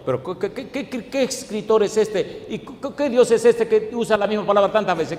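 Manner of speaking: high, stammering incredulity; mock bewilderment. Preacher playing a reader baffled by repeated wording, mock incredulous. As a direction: stumble over the question in feigned disbelief.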